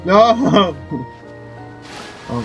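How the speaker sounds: usual speaking voice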